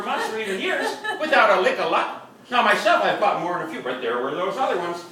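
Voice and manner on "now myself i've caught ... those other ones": usual speaking voice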